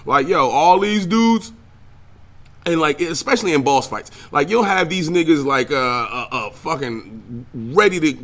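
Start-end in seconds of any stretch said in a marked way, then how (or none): none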